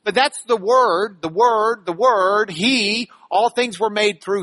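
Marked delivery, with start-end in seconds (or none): 0.0-2.5 "the Word" said three times, louder each time; repeating Word. Shown as a demonstration. Preacher stressing the Word, louder with each repeat. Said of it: "you see the emphasis he's putting on the Word".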